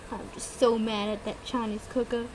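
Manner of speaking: ordinary delivery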